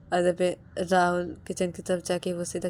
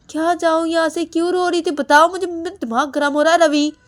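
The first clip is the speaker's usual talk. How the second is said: much higher, loud yelling; furious demand; 10 semitones higher, 12 dB louder than usual. Acted. Husband yelling at wife that he is getting angry. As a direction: lose your temper.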